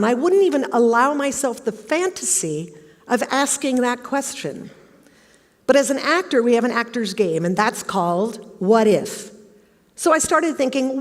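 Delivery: normal talking voice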